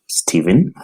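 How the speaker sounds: ordinary talk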